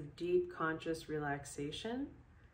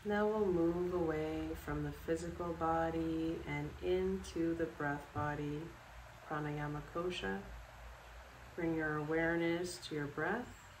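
calm, unhurried guided relaxation with long pauses; guided meditation; 3 dB quieter than usual. Acted. Yoga instructor guiding listeners to sleep in bed, calm meditation voice. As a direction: lead them through it step by step, leaving space after each instruction.

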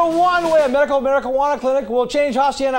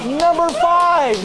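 slow, dragged-out "Number five" with a falling end; drawn-out number; 2 semitones higher, much slower than usual. Acted. Host announcing the countdown to the crowd, slow and dragged out.

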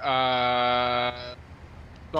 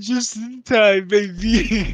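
loud, high, drawn-out excited cry; 8 semitones higher, 10 dB louder, slower than usual. Real, not acted. Arriving for the green juice, loud excited crowing. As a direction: crow it loudly, stretching the words.

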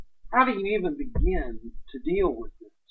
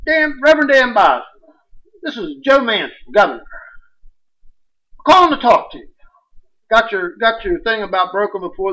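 high, loud mock governor on a machine; governor's phone message; 5 semitones higher, 6 dB louder than usual. Governor's voicemail to the preacher, hammed up and loud. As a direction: play back the governor's call, raised and loud. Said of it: "So she hit it".

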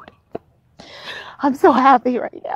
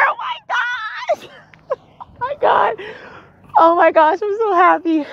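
overjoyed; 11 semitones higher than usual. Real, not acted. Daughter just passed driving test.

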